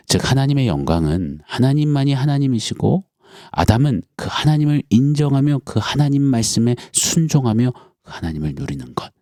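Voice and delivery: normal talking voice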